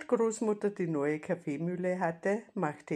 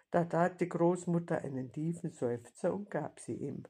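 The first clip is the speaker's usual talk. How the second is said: ordinary delivery, nothing marked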